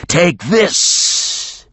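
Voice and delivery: a beast about to spew; dragon voice. A narrator's reading. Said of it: "the dragon said".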